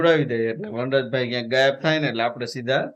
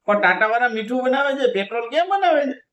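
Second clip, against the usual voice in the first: mock disbelief; 9 semitones higher than usual. Real, not acted. Husband mocks wife's Tata logic.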